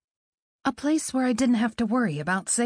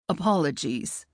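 lower, polite British-Scottish voice; accented man; 5 semitones lower than usual. Acted. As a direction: apologise low and courteous. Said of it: "the man said".

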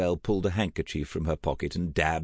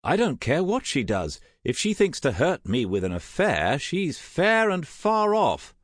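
higher, emphatic denial; rising defiance; indignant; 11 semitones higher, 4 dB louder than usual. Acted. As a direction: insist hotly, slowing on the last words. Stung husband insists he's unaffected.

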